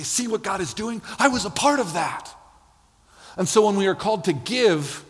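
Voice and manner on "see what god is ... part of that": high, eager voice bursting with pride; proud delight. Acted. Preacher voicing the Macedonian church, proudly excited. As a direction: show off the work you helped with.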